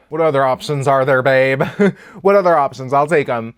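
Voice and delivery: loud retort that breaks into a laugh. Real, not acted. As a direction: shout it out, half joking. Joking loudly about her resilience.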